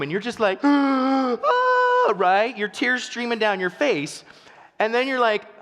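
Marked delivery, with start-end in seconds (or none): 0.6-2.1 loud, high hammed-up gasp; mock gasping for oxygen. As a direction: imitate helpless laughter fighting for air. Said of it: "Fighting for oxygen in the room".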